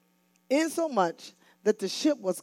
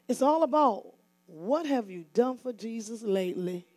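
ordinary talk, nothing marked

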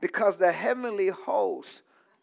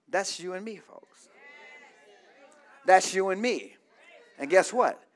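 ordinary talk

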